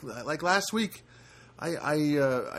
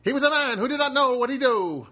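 higher, brassy movie-trailer announcer; fifties voiceover voice; 8 semitones higher, 4 dB louder than usual. Retold or imitated. Host imitating fifties movie voiceover guys, hammed-up. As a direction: ham up the old-movie narrator. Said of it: "they always had those kind of voices".

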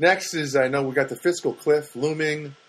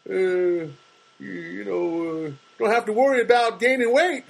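mocking bigot; 7 semitones higher, slower than usual. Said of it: "somebody came and said".

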